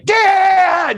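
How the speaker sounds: high, loud playful shout of his name; dramatic yell. Retold or imitated. Coming home and calling out for his dad, dramatic high yell. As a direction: call him out like a big theatrical scene. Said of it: "in this kind of dramatic, uh, voice".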